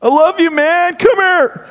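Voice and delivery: high, gushy buddy love; mock gushing affection. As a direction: pull a pal into a hug. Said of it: "what do you like?".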